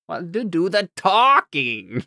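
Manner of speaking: high, slow, whiny mimicry; Puffin Forest guy. Host teasing cohost by imitating a YouTuber, slow and whiny. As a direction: teasingly echo the friend's whine. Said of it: "the guy from Puffin Forest".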